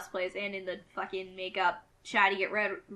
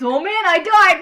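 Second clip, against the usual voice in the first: much higher, much louder hammed-up yell; mock gamer scream; 9 semitones higher, 16 dB louder than usual. Mocking a screaming Let's Player to friends, hammed-up yell. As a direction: parody an over-the-top streamer shouting. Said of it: "it's just them screaming most of the time".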